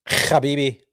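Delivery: "Habibi" opened with a long scraped kh; mock rasped kh. Shown as a demonstration. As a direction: scrape the start of "Habibi" in mockery. Repeats mocked kh pronunciation.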